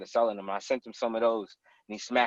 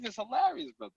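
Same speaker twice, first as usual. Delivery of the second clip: ordinary talk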